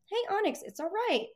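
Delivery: high, excited dog praise; the first, excited way to soothe the dog; happy, high-pitched. Shown as a demonstration. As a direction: lift the voice up high to cheer her. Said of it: "kinda happy, high-pitched".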